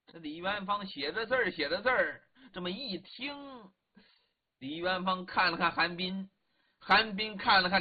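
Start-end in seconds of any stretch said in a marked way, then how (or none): none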